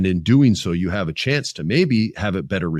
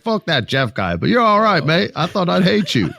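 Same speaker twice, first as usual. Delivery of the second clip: put-on Australian accent; 10 semitones higher than usual. Australian fan telling him he's all right, mock Australian accent. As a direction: ham up an Aussie fan's cheerful Australian accent. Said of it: "some Australian guy's like".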